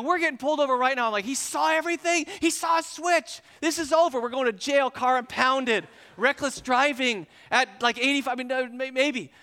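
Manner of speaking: high, loud, escalating panic; acted panic. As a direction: rattle off the consequences in alarm. Panicking about being pulled over.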